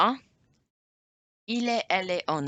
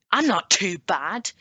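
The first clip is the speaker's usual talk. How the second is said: loud, higher-pitched aggressive delivery; mock aggression; 5 semitones higher, 7 dB louder than usual. Shown as a demonstration. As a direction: snap it out too forcefully for the words. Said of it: "say with a bit of aggression".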